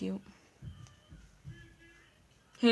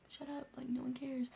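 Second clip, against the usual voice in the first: hushed whispered brush-off; 4 semitones higher, 14 dB quieter than usual. Retold or imitated. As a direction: whisper it dismissively. Whispering in class.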